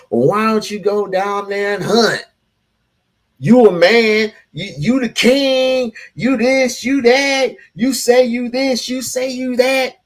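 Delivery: hammed-up mimicry of a scolding woman; mocking her rant. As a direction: parrot her rant back, sneering.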